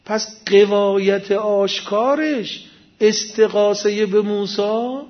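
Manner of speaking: usual speaking voice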